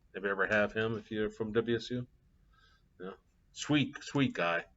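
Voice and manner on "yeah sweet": usual speaking voice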